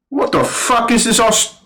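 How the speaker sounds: high-pitched mock British officer; attempted British accent. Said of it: "I can't do a British accent".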